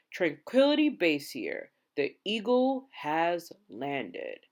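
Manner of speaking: as easy as reporting a parked car; calm radio report. A narrator's reading. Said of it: "calm as a man who just parked a car".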